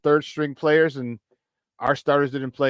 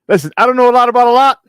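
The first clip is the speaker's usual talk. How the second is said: hype shout; 8 semitones higher, 11 dB louder, faster than usual. Real, not acted. Hypes the show to the audience.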